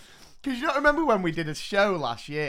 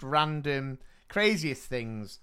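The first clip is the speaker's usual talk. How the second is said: normal talking voice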